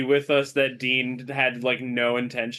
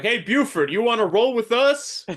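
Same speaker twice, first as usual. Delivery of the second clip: high, raised call to someone up front; calling from the back; 9 semitones higher, 3 dB louder than usual. Acted. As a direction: call out brightly from the back of a wagon. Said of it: "from the back of the wagon".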